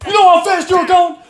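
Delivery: high mock shout at the driver; imitated cop yelling. Cop yelling at the driver, in a high mock shout. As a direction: bark it like a furious cop. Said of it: "the dude starts yelling".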